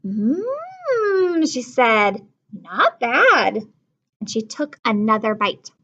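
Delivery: put-on lively read-aloud to children; storybook reading. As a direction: read it like a picture book to kids. Teacher reads a picture book.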